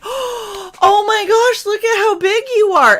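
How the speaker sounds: high, loud, drawn-out coo; gushing gasp. Retold or imitated. College girls cooing at the little boy, high loud gush. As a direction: gasp then coo at the cute kid.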